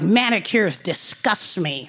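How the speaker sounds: low, slow gruff man's voice; lightly voiced grumpy old man; a hint of a stern old man; stern pedicurist.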